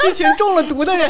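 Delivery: laughing through the words; giggling as she says it. Real, not acted. Joke about everyone getting poisoned.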